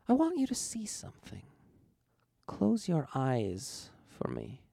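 starts high, then slows into a drawl; seductive drawl. A narrator's reading. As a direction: coax him slowly and seductively. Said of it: "with a seductive drawl".

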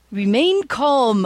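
slow, grand hero voice; heroic announcement. A narrator's reading. Captain Hammer bursting into the robbed bank, slow and grand. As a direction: announce it slowly like a pompous hero.

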